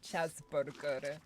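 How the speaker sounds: slow put-on European accent; mock European pronunciation, slowly; vague European accent. Shown as a demonstration. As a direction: draw it out in an unplaceable European accent. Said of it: "vaguely European".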